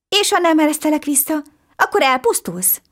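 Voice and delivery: put-on high, bright-eyed boy amazed at a talking fish; little boy voice. A narrator's reading. Little boy marvelling at the talking fish, high and eager. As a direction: pitch it way up like an excited small boy.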